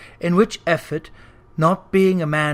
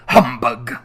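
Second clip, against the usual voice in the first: lower, louder miserly scoff; Scrooge scoffing; 7 semitones lower, 4 dB louder than usual. A narrator's reading. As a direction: spit the word out.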